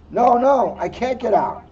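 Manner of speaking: mild panic. Acted.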